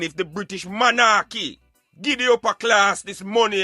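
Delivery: ordinary delivery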